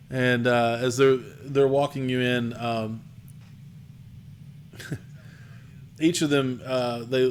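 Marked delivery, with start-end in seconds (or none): none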